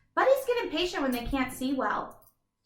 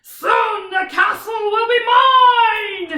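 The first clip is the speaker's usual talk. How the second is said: high, loud, slow dragon shout; roaring dragon threat; 9 semitones higher, 13 dB louder, much slower than usual. Shown as a demonstration. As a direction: bellow it like a villainous dragon. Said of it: "the dragon might say it like this".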